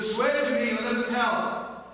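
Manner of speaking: high, loud and slow, pleading; urgent plea. Acted. Drunkard urging the father to swear secrecy, loud and slow. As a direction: demand the oath loudly, slowly.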